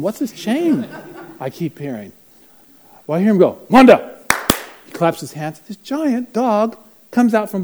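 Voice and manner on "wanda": loud, high call to a dog; summoning shout. Retold or imitated. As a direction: holler for the dog. Man summons his dog.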